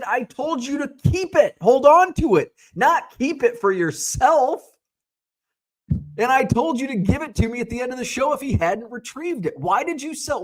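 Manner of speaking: high, heated telling-off; scolding the dealer for selling the card; retold scolding. To the dealer who sold the card, angry scolding.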